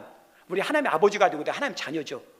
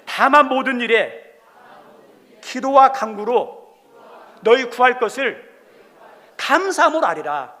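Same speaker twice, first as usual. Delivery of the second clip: louder, slow recitation for the church to repeat; slow scripture lead; 2 semitones higher, 5 dB louder than usual. Shown as a demonstration. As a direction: read each phrase out slowly for them to echo. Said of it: "저 따라 삽니다". (따라 읽도록 크고 천천히 낭독)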